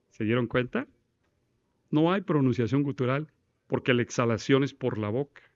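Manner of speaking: ordinary delivery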